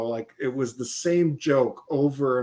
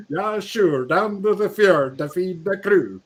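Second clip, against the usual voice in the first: higher, sing-song Swedish lilt; Swedish accent; 5 semitones higher than usual. Retold or imitated. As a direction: joke it like an old Swede. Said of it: "Swedish accent".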